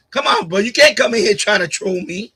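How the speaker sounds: normal talking voice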